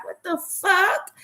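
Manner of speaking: squeaky, incredulous what the fuck; high baffled squeak; high-pitched disbelief. Retold or imitated. Waiting for pain, disbelief.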